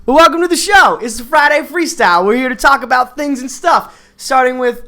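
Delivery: upbeat, high-pitched welcome; happy show intro. Real, not acted. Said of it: "You sounded so happy before all of that".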